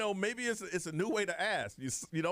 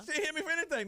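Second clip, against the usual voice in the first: emphatic denial; 14 semitones higher, faster than usual. Retold or imitated. Retorting to mom's suspicion.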